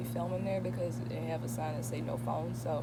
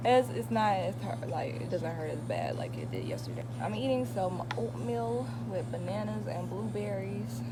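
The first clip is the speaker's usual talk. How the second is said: ordinary talk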